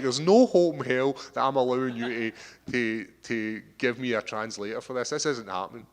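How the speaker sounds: loud, incredulous protest; adamant refusal. Acted. Acted refusal of a translator.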